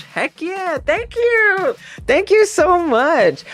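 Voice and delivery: high, gushing thank you; excited squeaky thanks. Real, not acted. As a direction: squeal your thanks to chat.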